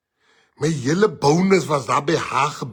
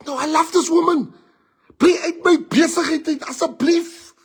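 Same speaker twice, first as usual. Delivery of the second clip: high begging voice, much higher; pleading lovestruck friend; 11 semitones higher, 3 dB louder than usual. Retold or imitated. Friend begs teller to stay out of his business, high pleading. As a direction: beg like a man blinded by love.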